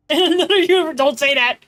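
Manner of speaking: loud, high laughing protest. Real, not acted. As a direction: cry out through laughter.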